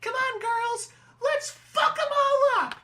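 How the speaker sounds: put-on very high, slow squeaky girl; cartoon girl voice. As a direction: cheer the girls on high.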